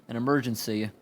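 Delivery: ordinary delivery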